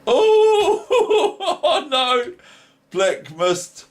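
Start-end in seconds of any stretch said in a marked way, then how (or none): 0.1-2.3 very high squeal breaking into laughter; squeal, then laugh it out. Real, not acted. Laughing at a blunder.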